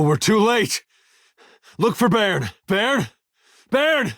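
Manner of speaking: urgent high-pitched cries; alarmed calling out. Acted. Companion calls for missing Baron, urgent and alarmed. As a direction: call out in rising alarm, voice jumping high. Searching for Baron after arriving too late.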